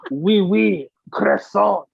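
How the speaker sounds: punchy cartoon French, word by word; mock French accent. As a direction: toss out French words in a hammed-up accent. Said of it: "with fucking French accent".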